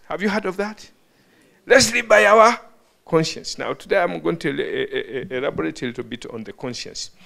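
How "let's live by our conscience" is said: loud, raised rally cry, dropping on the last word; imitating a politician addressing a crowd; politician's slogan. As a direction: call it out like a campaigning politician. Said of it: "among the politicians".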